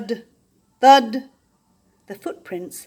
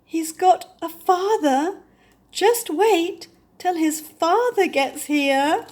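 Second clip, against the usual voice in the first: dawning dread; 9 semitones higher, 6 dB louder, slower than usual. Acted. Basil dreads the father.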